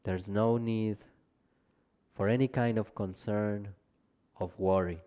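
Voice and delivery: calm Yoga Nidra guiding voice with long pauses; guided meditation. Real, not acted. Teacher leading listeners into Yoga Nidra rest, calm guided meditation. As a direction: ease them into rest, gently, leaving space between phrases.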